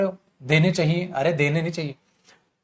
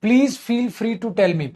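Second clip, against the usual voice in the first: slow reading; 4 semitones higher, much slower than usual. Shown as a demonstration. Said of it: "re-reads question slowly".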